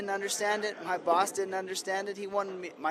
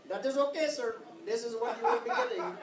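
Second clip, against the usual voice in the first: lightly put-on Indian accent, higher pitched; 3 semitones higher than usual. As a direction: imitate an Indian hotel keeper's accent. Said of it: "it was an Indian guy".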